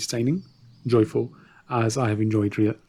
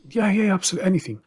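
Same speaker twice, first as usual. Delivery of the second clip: put-on eager drunk ruler; 9 semitones higher, much faster than usual.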